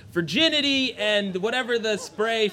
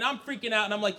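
ordinary talk

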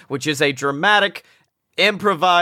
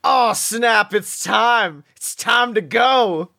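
hype wrestler; 7 semitones higher, slower than usual. Pretend. Guest hypes up wrestling recording.

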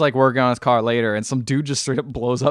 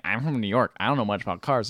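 low, quiet mock brush-off; uninterested New Yorker; 6 semitones lower, 8 dB quieter than usual. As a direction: imitate a guy who can't be bothered, voice dropped. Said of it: "He's just like".

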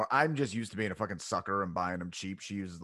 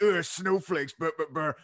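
higher-pitched mocking grumble; mock grumbling; 5 semitones higher, 3 dB louder than usual. Host parodying people who complain about snowflakes, mocking grumble. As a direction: sneer it as a parody, trailing into blubber. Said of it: "it's so easy to get like".

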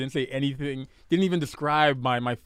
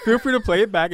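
laughing; 8 semitones higher, 7 dB louder than usual. Real, not acted. Laughs while teasing partner.

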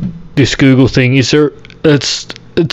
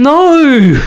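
anguished no; 10 semitones higher than usual. Retold or imitated. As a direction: wail at the screen. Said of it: "and I'm".

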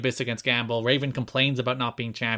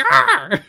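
shrill raven caw, very high; raspy cawing crow; 10 dB louder than usual. Retold or imitated. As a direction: squawk like a raven. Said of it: "an impression of a raven".

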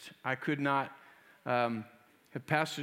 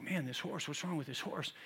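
puzzled grumble; 8 dB quieter than usual. Retold or imitated. Rider puzzled at the stuck horse.

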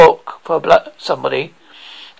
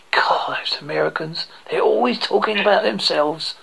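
whispered grumble, much softer; feigned private aside; 10 dB quieter than usual. Pretend. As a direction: mutter it under your breath as a mock-secret aside. Feigned aside he thinks unheard.

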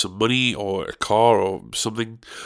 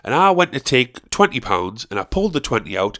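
ordinary delivery

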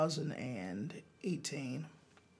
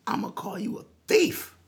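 loud, higher accusing snap; angry threat; 9 semitones higher, 7 dB louder than usual. Retold or imitated. Old man at the merch line threatening the singer, loud and angry. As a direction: threaten him, loud and high. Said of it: "He says".

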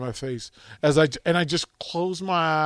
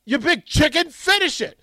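high mocking taunt from a cookie; 10 semitones higher, faster than usual. Cookie on the plate taunting the grown man, high and mocking. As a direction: goad him like a sneering cookie.

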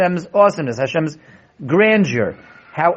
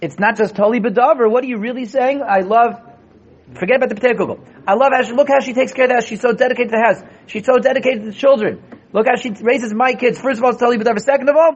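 fiery preaching; 8 semitones higher than usual. Acted. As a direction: hammer the point home to the class. Rebbe preaching against selfish love.

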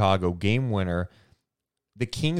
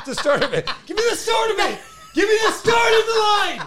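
much higher, laughing yell for the cue; laughing shout; 4 dB louder, faster than usual. Real, not acted. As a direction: demand the line through laughter. Laughing demand for the lyric.

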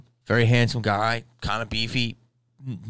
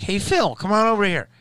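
high, louder call across the workplace; calling a coworker over; 12 semitones higher, 5 dB louder than usual. Acted. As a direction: holler for your buddy, pitched way up. Acted guy calling a coworker.

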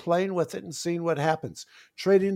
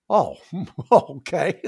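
laughing relief; 6 dB louder, slower than usual. Retold or imitated. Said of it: "they go".

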